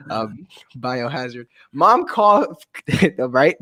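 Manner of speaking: ordinary delivery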